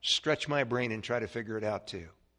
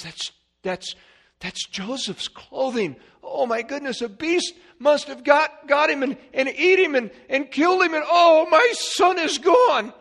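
grieving father; 14 semitones higher, 9 dB louder than usual. Acted. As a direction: wail it as the old father losing his son. Father sees son's bloody coat.

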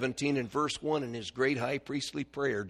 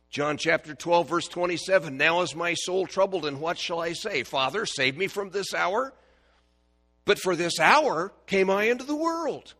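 preaching at full force; 5 semitones higher, 10 dB louder than usual. Acted. Preacher to congregation, loud fervent proclaiming. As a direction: proclaim it to the back pews.

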